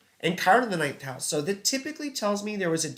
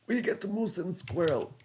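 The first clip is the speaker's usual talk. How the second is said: softer, mock cartoon Russian accent; Boris cartoon Russian accent; 3 semitones higher, 5 dB quieter than usual. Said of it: "I'm doing Boris and Natasha".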